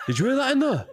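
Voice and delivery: high-pitched mock alarm; sudden high, put-on question; Carol impression. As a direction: mimic a medium startled by a noise. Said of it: "she just stops and she goes".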